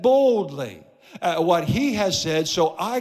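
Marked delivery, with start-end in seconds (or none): 0.0-0.8 BOLDLY stretched out and punched; bold emphasis. Shown as a demonstration. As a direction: lean hard on BOLDLY, then let it fall. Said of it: "I have to say it boldly".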